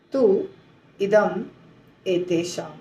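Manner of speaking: तू, इदम, एतेषाम with pauses between; word by word. Shown as a demonstration. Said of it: "ये तीन शब्द जुड़े हुए हैं". (शब्द-शब्द अलग करके)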